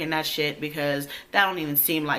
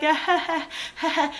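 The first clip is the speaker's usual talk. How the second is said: high, forced polite laugh; fake laugh; 12 semitones higher than usual. Humoring a man rambling about a game, fake laugh. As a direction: imitate humoring him with a hollow laugh.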